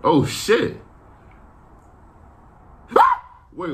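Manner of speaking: usual speaking voice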